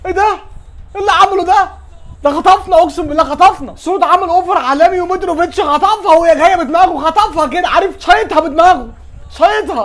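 loud, high amazed exclaiming; excited shout. Real, not acted. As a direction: exclaim in disbelief at the goal. Amazed at the goal.

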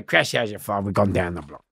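ordinary delivery

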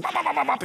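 rapid-fire high yapping noise; imitated barking. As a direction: yap like a dog going wild. Imitates young dog barking wildly. (schnelles, hohes, nachgeahmtes Kläffen)

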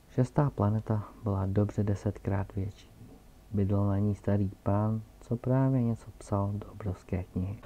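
usual speaking voice

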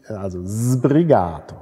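„sbrigato“ said slowly, stress and a long open A on -ga-; slow teaching model of „sbrigato“; slow stress demo. Shown as a demonstration. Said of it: "der betonte Vokal A ist gelenkt".

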